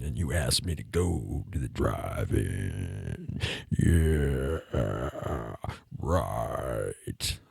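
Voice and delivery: extremely deep, croaky seducer; absurdly low put-on bass; frog with laryngitis. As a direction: croak it slowly at the very bottom of your voice. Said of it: "I sound like a frog with laryngitis".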